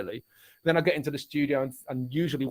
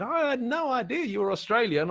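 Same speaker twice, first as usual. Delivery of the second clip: retold surprise; 8 semitones higher than usual. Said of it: "people that said".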